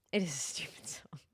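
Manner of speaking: low, whispery aside; quiet aside. Real, not acted. Streamer admitting the song is silly, whispered aside. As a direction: drop to a near whisper.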